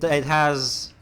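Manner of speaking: ordinary delivery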